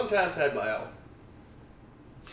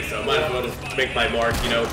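ordinary talk, nothing marked

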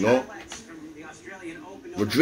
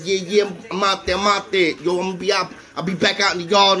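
higher-pitched, eager retold boasting; hyped-up reply; 6 semitones higher than usual. Answering inmates before the visit, hyped up. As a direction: replay your own cocky excitement.

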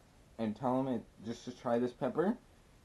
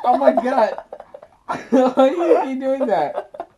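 laughing speech; 12 semitones higher, 13 dB louder, slower than usual. Real, not acted. Laughing at her friend.

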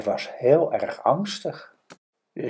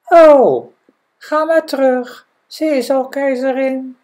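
high, loud fish voice; high-pitched storybook fish; magic fish; 13 semitones higher, 10 dB louder than usual. Acted. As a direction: grant the wish in a high creature voice. The fish grants the wish.

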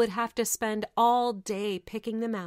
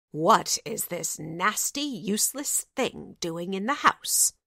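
harsh, sneering stepmother voice; wicked stepmother. Acted. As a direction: spit it out with contempt. Stepmother scorns the girl.

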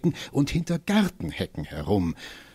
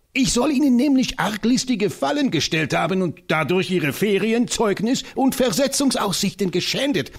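high, fiery voice of the tiny professor; rally for battle with comic zeal; an excitable old scholar, pitched high; eccentric little professor; 9 semitones higher than usual. Acted.

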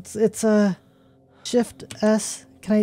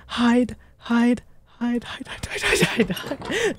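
frantic hushed chant; panicked whisper; 3 semitones higher, 4 dB quieter, much slower than usual. Real, not acted. Hiding from monster.

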